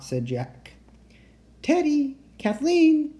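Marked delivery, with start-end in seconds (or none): 1.6-3.1 high, drawn-out call for someone unseen. A narrator's reading. Annie calls for her missing friends, calling out. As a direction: call their names out across the distance. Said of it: "Annie called".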